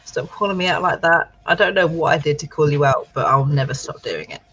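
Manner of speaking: normal talking voice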